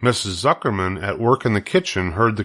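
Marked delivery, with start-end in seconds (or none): none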